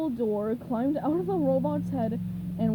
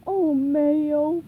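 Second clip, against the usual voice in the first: fondly; 3 semitones higher, 9 dB louder than usual. A narrator's reading. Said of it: "Patrick said fondly".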